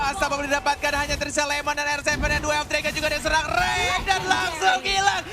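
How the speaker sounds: high, loud climactic call; esports caster. Real, not acted. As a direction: scream the finale like a caster. (teriakan komentator esports di akhir laga)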